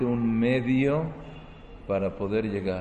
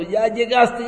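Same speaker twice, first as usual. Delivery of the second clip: high, loud climax call; excited cry; 12 semitones higher, 9 dB louder than usual. Acted. Preacher acting out carrying a giant window, loud high cry. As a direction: shout it like you almost made it.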